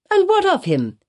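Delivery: high, eager cry that drops away. A narrator's reading. Chillingworth questioning Hester on a topic he loves, eagerly. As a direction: start high with keen interest. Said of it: "cried Roger Chillingworth eagerly".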